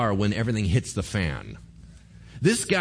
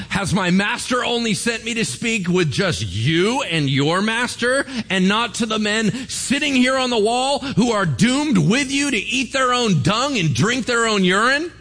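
high, loud, mocking bellow; a commander bellowing back at the men on the wall; louder bully; 8 semitones higher, 4 dB louder than usual. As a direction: get louder to drown them out. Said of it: "He just gets louder".

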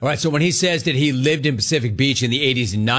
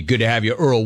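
normal talking voice

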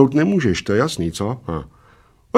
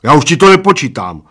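loud, higher-pitched refusal; obstinate, sulky announcement; stubborn; 4 semitones higher, 7 dB louder than usual. A narrator's reading. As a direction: dig your heels in and refuse. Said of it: "oznámil Plešatec umíněně".

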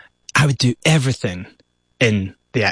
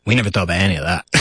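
ordinary talk, nothing marked

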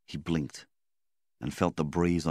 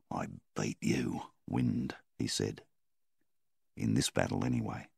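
weak murmur; 6 semitones lower, 6 dB quieter than usual. A narrator's reading. Milvan just revived, murmuring to the wind, low and soft. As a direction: barely get it out, exhausted.

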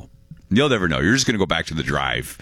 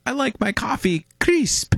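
put-on old lady voice. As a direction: sweetly imitate a little old lady. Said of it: "And the lady says".

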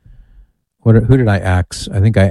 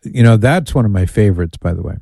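ordinary talk

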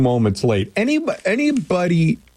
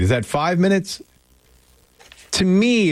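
ordinary talk, nothing marked

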